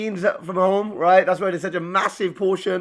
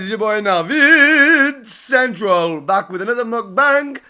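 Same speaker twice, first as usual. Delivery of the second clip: hype intro; 6 semitones higher, 7 dB louder, slower than usual. Acted. YouTuber hype intro greeting.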